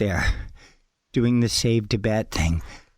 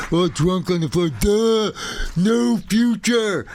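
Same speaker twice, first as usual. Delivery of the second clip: higher, slow, dopey mock punk drone; mock burnout punk; 6 semitones higher, slower than usual. Mocking old-school punks, a dopey drunk punk voice. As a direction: sneer it like a wasted nihilist punk.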